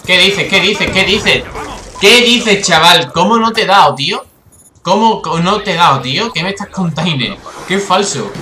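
loud, high, incredulous outburst; indignant disbelief. Real, not acted. Player to the game after shots miss, indignant disbelief. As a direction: fire off repeated protests, building in pitch and volume.

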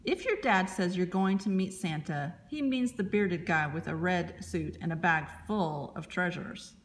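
ordinary delivery, nothing marked

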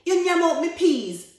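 high, very loud accusation; outraged cry. A narrator's reading. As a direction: holler in protest at being robbed. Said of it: "cried Anansi".

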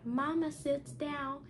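put-on bright, steady read-aloud voice for children; reading a story aloud. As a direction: read the picture book to the class, a little lifted. Teacher reads picture book aloud.

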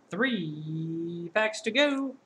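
slow, drawn-out ring announcer call; wrestling announcer. Real, not acted. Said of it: "Sound like a wrestling announcer now".

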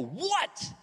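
high, sharp shouted What. Retold or imitated. Husband snapping at wife in front seat, sharp high What. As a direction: snap it at her like a startled outburst. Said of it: "Like that".

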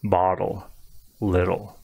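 bottle, little with a tapped D in the middle. Shown as a demonstration. Teacher showing how others hear it, D sound. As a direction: say bottle, little smoothly, T as a light D. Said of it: "it sounds like a D sound".

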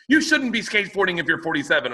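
high scornful woman; scolding neighbor. Retold or imitated. Neighbor lecturing a forty-seven-year-old, high mimicry. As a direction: lecture him about his age. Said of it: "And she goes".

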